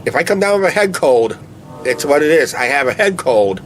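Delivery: ordinary delivery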